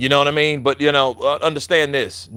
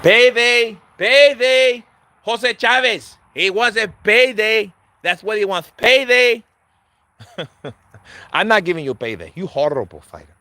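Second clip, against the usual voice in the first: mimicking Canelo; 6 semitones higher than usual.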